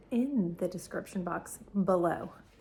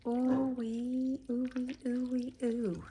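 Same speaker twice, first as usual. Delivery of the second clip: drawn-out ooh wee; 5 semitones higher, 5 dB quieter, slower than usual. Real, not acted. Savoring the dish, drawn out.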